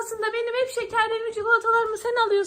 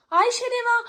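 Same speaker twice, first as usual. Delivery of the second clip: usual speaking voice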